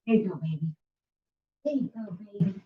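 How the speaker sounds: gentle, soft words to the dog; soft dog talk. Real, not acted. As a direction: coo softly to a pet. Talking softly to the dog.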